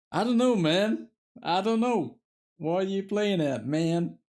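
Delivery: put-on American surfer twang. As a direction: do a laid-back American surfer dude. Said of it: "you will get a surfer from America".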